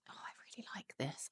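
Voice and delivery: hushed whisper of delight. Real, not acted. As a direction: whisper it in quiet awe. Smelling a fragrance she loves.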